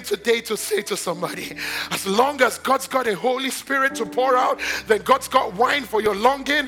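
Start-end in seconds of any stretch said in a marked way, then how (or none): 1.9-6.7 loud, rising preacher's cry; full-force preaching. Acted. Preacher crying out to the congregation about new wine, loud preaching. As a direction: proclaim it to the whole church.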